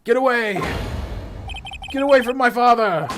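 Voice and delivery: high, loud protective yell; desperate shout. Acted. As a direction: shout the attacker away from a parent. Acted child defends father.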